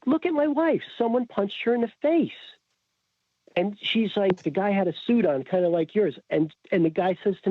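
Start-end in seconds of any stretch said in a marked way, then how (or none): 0.1-2.3 high-pitched insistent plea; retold exasperated appeal, pitched way up; indignant protest. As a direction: plead your case, voice jumping high. Indignantly pleading with the officer.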